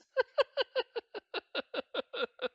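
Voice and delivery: a real, high-pitched laugh at himself; high burst of laughter. Real, not acted. Teacher laughing at his own British accent. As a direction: crack up laughing at the bad accent.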